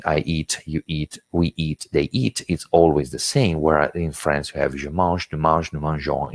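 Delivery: ordinary talk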